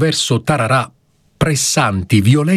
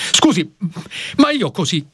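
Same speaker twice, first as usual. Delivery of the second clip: higher, jumping up to object; protesting lawyer; 11 semitones higher than usual. Acted. Young lawyer objects to the judge, higher indignant protest. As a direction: cut in, flustered and indignant. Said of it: "insorse l'avvocato difensore balzando in piedi".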